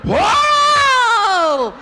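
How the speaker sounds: huge high drawn-out "whoa"; wife's labor yell. Retold or imitated. Husband retells his wife's first labor pain, huge high yell. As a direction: howl it out at full volume. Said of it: "She says".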